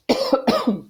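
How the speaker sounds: single dry cough; short throat-clearing cough. Real, not acted. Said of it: "Desculpa a tosse aí".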